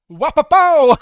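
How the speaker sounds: loud, high comic "wapapow"; imitated sample noise. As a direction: shout it like a sound effect going off. Imitates the sample sound mentioned earlier.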